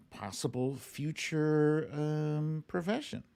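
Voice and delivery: normal talking voice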